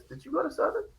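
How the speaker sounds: high, soft imitation of a woman; imitated flirty question. Husband imitates wife's question at their first meeting, high and soft.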